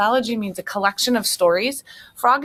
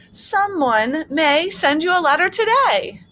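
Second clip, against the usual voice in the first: hopeful Frog; 10 semitones higher, 4 dB louder than usual. Acted.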